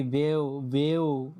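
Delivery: বেও split into "be-o"; wrong pronunciation demo. Shown as a demonstration. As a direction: slowly say বেও as two vowels, "ay" then "o". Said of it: "উচ্চারণ ভুল হবে তাহলে".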